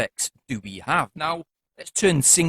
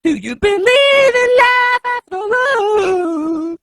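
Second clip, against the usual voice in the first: loud robotic autotuned singing; 11 semitones higher, 7 dB louder, much slower than usual. Shown as a demonstration. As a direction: sing it through autotune. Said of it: "if you wanna sound like [name]".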